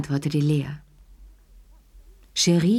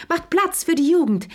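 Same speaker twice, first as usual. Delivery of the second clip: rising gushing cry; 12 semitones higher than usual. A narrator's reading. Madame Pelou crying out to make way for youth. Repeated phrase, second one higher.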